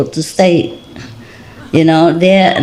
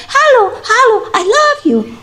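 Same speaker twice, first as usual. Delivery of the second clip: very high imitated dog greeting; talking dog. Owner imitating dog's greeting, talking dog. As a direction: squeak it out like a talking pet. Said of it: "And sometimes he said".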